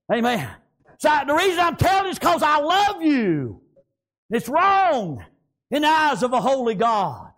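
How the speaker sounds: high, shouted pulpit exhortation; impassioned preaching. Real, not acted. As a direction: cry it out to the congregation. Preaching at full force.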